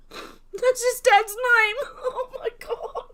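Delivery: high, slow words squeezed through laughter; laughing to the point of tears; helpless laughter. Real, not acted. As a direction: start loud, dissolve into breathless laughing. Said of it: "if you're wondering why I'm laughing".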